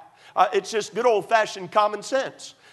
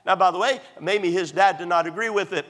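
ordinary delivery

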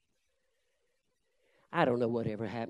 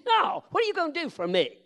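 much higher mocking put-on voice; sneering young man; 9 dB louder than usual. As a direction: scoff at the preacher in a silly high voice. Mocking reply to the preacher.

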